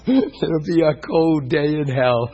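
laughing through the words, slowed down. Real, not acted. Preacher to the congregation, laughing off the idea he was hurt. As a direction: laugh it off, dragging each word out.

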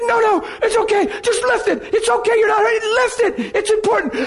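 very high, strained, pained reassuring; high pained pleading; strained reassurance. Retold or imitated. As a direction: reassure the kids through pain, high. Retold strained reassurance in pain.

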